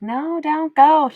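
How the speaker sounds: high, drawn-out imitation of kids pleading. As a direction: mimic children begging, high and stretched out. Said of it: "they were like".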